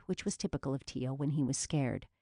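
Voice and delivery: usual speaking voice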